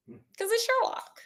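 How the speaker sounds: high, soft, smug tag; lightly voiced smug detective; smug Sherlock. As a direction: toss it off with lofty pride. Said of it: "So Sherlock is like".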